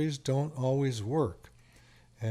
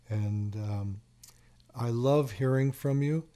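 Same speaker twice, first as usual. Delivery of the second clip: ordinary talk, nothing marked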